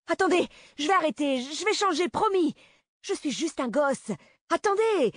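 put-on very high, frantic boy's pleas; acted terror, crying out for mercy; desperate begging. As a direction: promise anything to stay alive, voice soaring. Boy begs before being shot.

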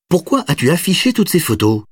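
put-on high, puzzled little boy; surprised child. A narrator's reading. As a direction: ask wide-eyed like a kid. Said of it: "s'étonna l'enfant". (enfant étonné)

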